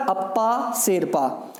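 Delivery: drawn out slowly, each word spaced; slow name. Shown as a demonstration. Teacher makes students memorise the name, slow. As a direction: spell the name out slowly for the class. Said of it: "अब क्या नाम उनका?".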